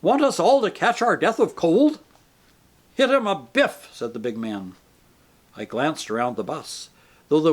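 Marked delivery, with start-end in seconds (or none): 0.0-2.0 loud, higher scolding; angry rebuke. A narrator's reading. Intelligent man scolds narrator about open window, loud and angry. 2.9-3.9 rough urging. A narrator's reading. Big man urging violence.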